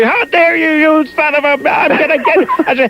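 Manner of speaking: very high-pitched imitation of a frantic wife; squealed mock tirade, sputtering out unfinished; mock hysterical outrage. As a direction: ham up a betrayed wife losing her mind. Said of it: "she's going insane".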